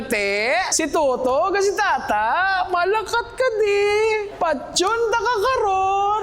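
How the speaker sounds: lilting, sing-song Ilonggo lines, pitched high; sweet-sounding Ilonggo. Shown as a demonstration. Teacher demos the Ilonggo lilt to a class. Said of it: "Oh, ganda, di ba?".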